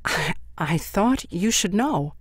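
slow, halting repeat with a breathy start; mother repeating herself, shaken; faltering. A narrator's reading. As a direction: stumble over the first word, shaken. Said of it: "cleared her throat and repeated herself".

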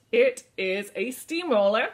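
normal talking voice

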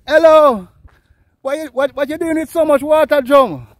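loud, high shout across to a neighbour; calling out. Real, not acted. Vlogger hails a lady carrying water, loud and high. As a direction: call out to someone far off, pitch up.

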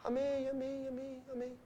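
soft, fading murmur of amen. Retold or imitated. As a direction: mumble the amens under your breath. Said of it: "E eu fiquei aqui assim". (amém murmurado, bem baixinho)

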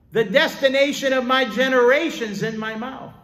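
high, loud proclamation; fervent declaration. Acted. Preacher decreeing for future generations, fervent declaration. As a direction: decree it at full voice, pitched high.

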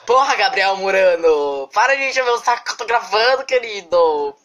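loud high exasperated yell. Real, not acted. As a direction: shout at a pestering friend. Yelling at friend off camera.

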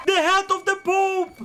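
high shouted boast; gleeful yell; triumphant shout. Real, not acted. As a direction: crow it at the top of your voice. Triumphant repeat.

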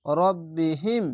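রব্বিহিম drawn out with a doubled ব; slow recitation. Shown as a demonstration. Teacher modelling the reading, slow recitation. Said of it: "teacher demonstrates recitation".

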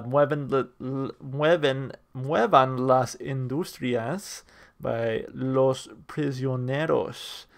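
slow, careful attempt at a Spanish accent on the title; sounding out the Spanish words one by one in a Spanish accent; best Spanish accent. Shown as a demonstration. Reviewer reading out a Chilean band's song title, slow halting Spanish accent. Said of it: "that's my best Spanish. It's the best accent I can do".

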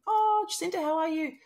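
high, sugary mock greeting from the old bully; bully's greeting. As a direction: lightly mimic his falsely cheerful hello. Imitates bully's friendly greeting.

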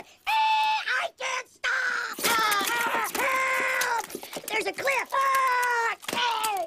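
high, loud terrified screams; panicked screaming. Acted. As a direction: shriek as the car flies. Crash panic, much higher retake.

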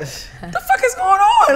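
high, slow mock disbelief; bewildered outburst. Reacting to a Twilight Zone moment, bewildered. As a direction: draw it out high, utterly baffled.

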